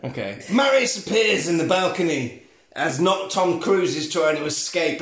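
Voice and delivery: usual speaking voice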